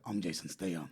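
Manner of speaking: low, soft Cockney tough guy; deep, understated Cockney impression; Jason Statham. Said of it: "he's got that Cockney accent though".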